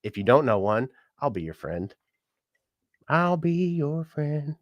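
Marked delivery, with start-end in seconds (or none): 3.1-4.6 sing-song drawn-out offer; slow, higher drawn-out repeat. Shown as a demonstration. To new viewers, a slow high drawn-out offer of friendship.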